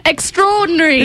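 a British extraordinary, up high; British accent word. Shown as a demonstration. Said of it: "I can just imitate them".

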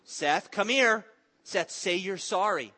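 stern shout. Acted. Father ordering his son to apologise, stern and loud. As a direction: bark it out, patience gone.

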